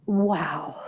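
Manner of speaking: breathy whisper of awe; hushed, wonder-struck wow. Shown as a demonstration. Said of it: "By just saying".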